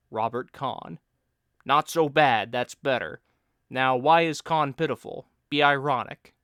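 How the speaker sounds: the needling pal playing teacher; Bill's voice. Bill scolding his friend's poor irony, loud banter.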